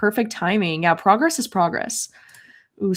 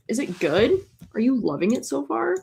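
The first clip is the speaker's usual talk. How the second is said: ordinary talk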